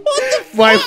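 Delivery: high, loud stunned laugh-shout; shocked laughing outburst. Real, not acted. Reacting to the film scene, a shocked laughing outburst. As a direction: blurt it out in disbelief, laughing.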